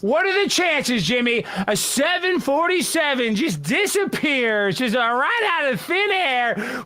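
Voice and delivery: slow, mock-serious question. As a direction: drag it out like a conspiracy host.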